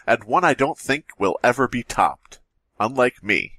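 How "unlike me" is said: normal talking voice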